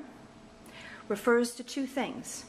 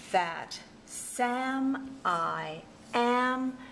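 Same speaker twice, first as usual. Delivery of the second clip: "that SAM I AM", each syllable held long; drawn-out, syllable-by-syllable "that Sam I am"; meter spelled out; 3 semitones higher, much slower than usual. Shown as a demonstration. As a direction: slowly separate every syllable, weak THAT, strong SAM, weak I, strong AM. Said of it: "the pattern looks like that".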